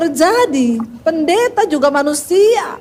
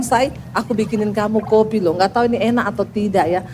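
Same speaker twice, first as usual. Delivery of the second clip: tender husband; 7 semitones lower than usual. Retold or imitated. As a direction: coax her sweetly. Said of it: "dia bilang".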